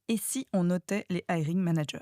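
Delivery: soufflé tout près du micro, sans voix; murmure-le à l'oreille; ASMR whisper. Pretend. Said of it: "Je fais un S M R". (chuchotement ASMR)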